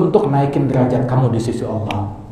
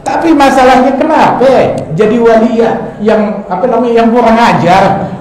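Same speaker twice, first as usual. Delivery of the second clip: louder, higher mock complaint; mock exasperation; 7 semitones higher, 5 dB louder than usual. Real, not acted. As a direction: throw up your hands at the rude saint. Preacher's indignant joke.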